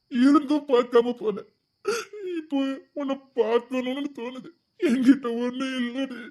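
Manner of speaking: slow, drawn-out tearful lament; broken husband weeping in self-reproach; crying. Acted. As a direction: sob through the words, pausing to weep. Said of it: "அழாதீங்கய்யா".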